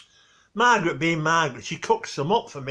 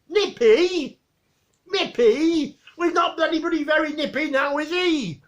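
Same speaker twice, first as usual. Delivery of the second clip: mocking Victor; 11 semitones higher, 3 dB louder than usual. Victor mocking the dog's name.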